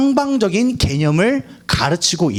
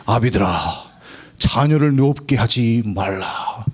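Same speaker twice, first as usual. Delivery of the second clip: solemn reading; 6 semitones lower, slower than usual. Acted. Reading scripture gravely.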